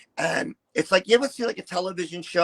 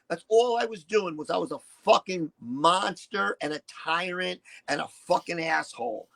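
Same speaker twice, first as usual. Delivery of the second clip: usual speaking voice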